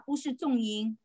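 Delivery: ordinary talk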